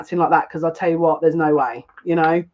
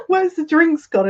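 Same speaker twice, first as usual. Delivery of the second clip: disbelief; 11 semitones higher, slower than usual. Retold or imitated. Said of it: "I said".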